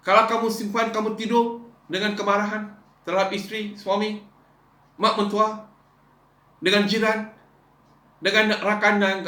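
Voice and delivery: loud, high fiery sermon; preaching at full force. Acted. Pastor exhorting the congregation, loud and high. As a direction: pound it home to the church, full voice.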